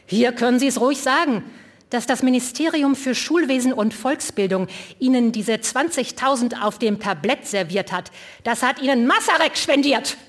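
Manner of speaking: loud jibe at the mayor; calling out. Acted. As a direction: call it out to the mayor. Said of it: "rief Liborius".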